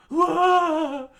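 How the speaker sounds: very high, loud surprise; high startled whoa. Acted. Chikara kissed on the cheek, very high startled cry. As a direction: jolt it out.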